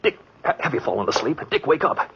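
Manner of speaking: rising frantic demand for the gun; panicked pleading. Acted. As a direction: beg for the gun as the killer comes. Panic as killer approaches.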